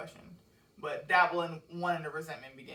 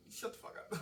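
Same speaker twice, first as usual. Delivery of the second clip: dismissive hush; 5 semitones higher, 10 dB quieter than usual. Shown as a demonstration. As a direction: wave them off under your breath. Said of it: "that really is my move sometimes".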